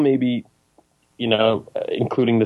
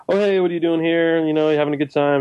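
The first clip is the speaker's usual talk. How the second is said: higher, quick, chirpy stranger greeting; mock friendly ranger; 7 semitones higher, much faster than usual. Imitating the creepy park ranger greeting her, higher and fast. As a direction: lightly play the too-friendly man in the woods. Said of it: "and he's like".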